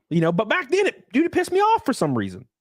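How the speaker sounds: ordinary talk, nothing marked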